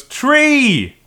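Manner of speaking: high, loud cry of "Tree!"; mock cry. Retelling the film's bungled gag, mock cry of "Tree!". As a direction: call it out high and drawn out. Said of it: "and she goes".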